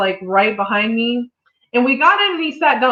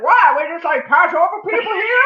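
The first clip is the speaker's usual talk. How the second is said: put-on higher, indignant old man; old man's indignant voice; 7 semitones higher than usual. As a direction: mimic a grumpy old man, outraged. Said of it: "This is how he sounded".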